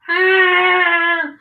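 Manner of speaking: high, loud startled yelp for the TV-head guy; mock scream. TV-head guy on cover thinking.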